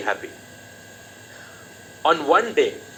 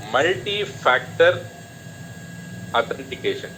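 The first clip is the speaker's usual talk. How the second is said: multi-factor authentication drawn out slowly, syllable by syllable; slow spelled-out term. Shown as a demonstration. Teacher spelling out multi-factor authentication to students, slow and deliberate. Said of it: "M F A meaning".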